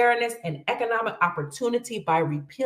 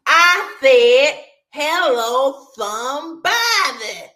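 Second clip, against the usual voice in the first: loud, high-pitched preacher's call, lifting to the crowd; imitating the preacher; 7 semitones higher, 9 dB louder, slower than usual. Community preacher getting excited, calling to his congregation, hammed-up loud preacher. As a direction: shout it out like an excited church preacher. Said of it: "he would say".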